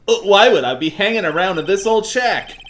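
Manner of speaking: high, whiny protesting character voice; Larry. Acted. Game character protests about the shack, whiny. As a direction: complain indignantly, pitched well up. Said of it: "doing the Larry voice".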